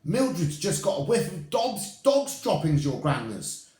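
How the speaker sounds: high, loud witch call; calling back. Acted. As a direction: call up to the platform. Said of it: "someone called back to her".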